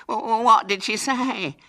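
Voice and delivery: high, loud, drawn-out wail; tearful. A narrator's reading. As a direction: wail the question through tears. Hubert asks through sobs.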